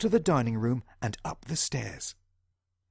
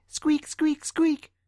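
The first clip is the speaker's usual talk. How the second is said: high, louder squeak; mouse squeak; 12 semitones higher, 4 dB louder, much slower than usual. Acted. John Mouse squeaking to wake Mister Simpkin. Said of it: "he squeaked".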